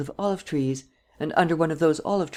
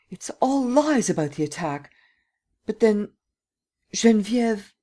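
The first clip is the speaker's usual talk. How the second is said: put-on alarmed old lady; 8 semitones higher, 3 dB louder than usual. A narrator's reading. Old lady realizes the deceit.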